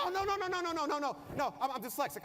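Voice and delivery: high, loud frantic string of no; a kid frantically taking it back; panicked backpedal. Retold or imitated. Son panicking to take it back.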